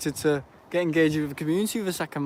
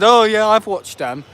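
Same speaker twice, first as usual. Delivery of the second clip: quoting uncle; 5 semitones higher, 6 dB louder than usual. Retold or imitated. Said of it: "Even my Uncle Colin said".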